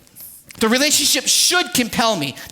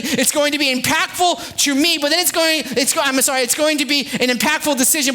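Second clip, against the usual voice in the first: ordinary talk, nothing marked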